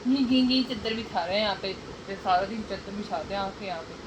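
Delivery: usual speaking voice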